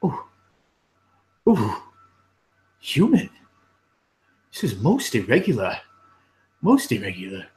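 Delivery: startled fussy clerk; lightly voiced flustered clerk. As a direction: sputter it like a prim clerk taken aback. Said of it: "and he goes".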